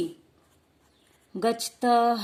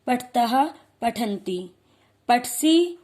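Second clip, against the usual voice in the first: ordinary talk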